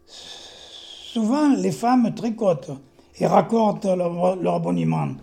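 slow, measured verse delivery with lifted emphasis; poem recitation. Acted. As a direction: recite your poem to the room, loud and deliberate. Recites own poem aloud. (récitation de poème, lente et bien scandée)

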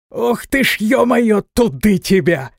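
high, loud pained curse; pained outcry. A narrator's reading. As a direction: yelp and curse from the hit. Hit by a stone, cries out.

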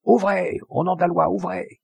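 high, official call through a door; shouted command. A narrator's reading. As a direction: call out sternly, pitched well up.

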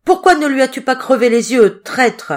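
loud, high-pitched scolding witch; angry shout. A narrator's reading. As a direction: shout the accusation at a traitor. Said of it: "Elle rossa le chat et cria".

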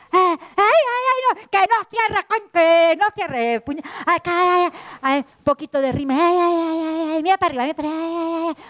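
high, whining ouch cries; squeals of discomfort. Acted. Lie scolds Truth while doing her eyeliner, high whiny squeals. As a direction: flinch and squeal at the pencil.

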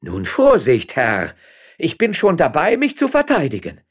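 high, loud, defiant warning; shouted warning. A narrator's reading. Woman raises her gun against the magistrate, shouted warning. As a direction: shout as you ready to defend yourself.

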